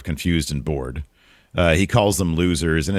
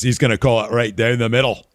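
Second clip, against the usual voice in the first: put-on British Bulldog accent; 6 semitones higher than usual. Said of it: "That was not a good Bulldog accent".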